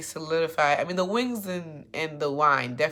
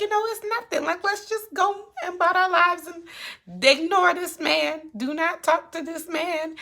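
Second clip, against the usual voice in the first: a prim brush-off voice; imitating Issa; 5 dB louder than usual. Recapper mimics Issa telling Nathan to ignore Lawrence. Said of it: "And Issa's just like".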